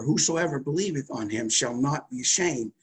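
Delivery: ordinary delivery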